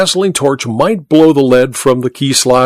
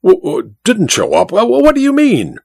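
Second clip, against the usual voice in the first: higher, stammering disbelief; stammering shock; 10 semitones higher than usual. A narrator's reading. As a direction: stumble over the words, stunned.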